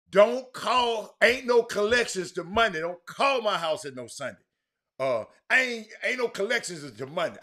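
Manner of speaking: hammed-up loud, high telling-off; mock loud warning. Dale warning people not to call, mock loud shout. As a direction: play Dale barking his rule at callers, loud and high. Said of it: "Dale Griggs told them people".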